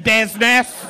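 loud, hammed-up Scouse BUSINESS; Scouse twang. Comic mimicking Greek student's sudden Scouse slip, loud mock Scouse. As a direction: punch out the word in mock thick Scouse. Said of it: "like, a Scouse twang".

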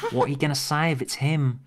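put-on Tegan's Australian accent, second try. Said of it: "That's not bad".

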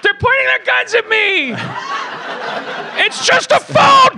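high, shouting in terror; frantic, half laughing; panicked caller. Acted. Caller as police arrive.

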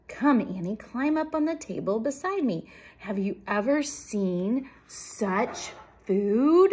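loud, swooping storybook enthusiasm; eager hostess mouse; excited urging. A narrator's reading. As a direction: show off proudly, stretching the last words. Story mouse shows off feast.

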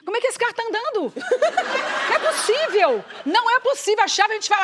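mais agudo e afobada; incrédula, entrando em pânico; pânico agitado. [name] em pânico no carro. ([name] em pânico com o carro sem chave)

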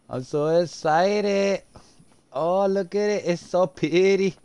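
high, slow, swooning voice; gushing girly voice. Pretend. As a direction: coo over it like a fangirl. Gushing over new car.

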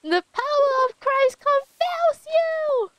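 high, slow, drawn-out exorcism cry; mock exorcist. Streamer jokingly exorcising the annoying lady, mock exorcist. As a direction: cast out the demon, hammed-up and high.

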